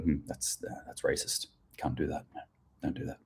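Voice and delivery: very soft, hushed mock reproach; mimicked disapproval. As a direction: imitate an uneasy listener, barely voiced. Mimics audience disapproving of accent.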